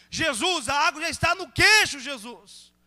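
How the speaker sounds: loud, high cry to Jesus; acted alarm as the water rises; urgent plea. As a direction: call out urgently in prayer.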